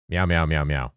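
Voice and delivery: quick little burst of meows; meowing on request. Pretend. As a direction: meow a few times for the detector. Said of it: "should meow more often".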